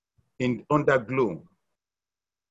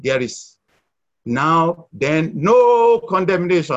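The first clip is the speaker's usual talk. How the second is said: slow and loud on "no condemnation", each word leaned on; emphasis on no condemnation; 4 semitones higher, 7 dB louder, slower than usual. Shown as a demonstration. Preacher stressing "no condemnation" to the church, slow and loud. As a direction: press hard on "no condemnation" to the congregation. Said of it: "put emphasis on those two words".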